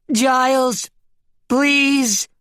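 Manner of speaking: high, desperate plea; crying out. A narrator's reading. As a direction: call out imploringly to a lover. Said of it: "cried the Bryn sheep".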